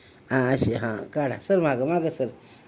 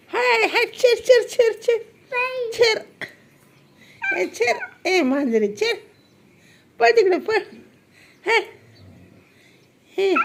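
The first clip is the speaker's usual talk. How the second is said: much higher calls to shoo the cat off. Real, not acted. Mother shoos the biting cat away.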